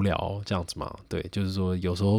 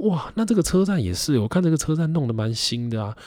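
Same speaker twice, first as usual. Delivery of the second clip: curious; 8 semitones higher than usual. Retold or imitated. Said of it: "我就会问他说".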